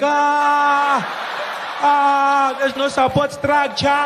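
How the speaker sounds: high, slow panicked yelp; panicking swimsuit. Pretend. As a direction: cry out like the costume is losing its grip. The panicking costume speaks.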